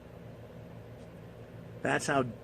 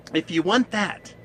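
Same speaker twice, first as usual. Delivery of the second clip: normal talking voice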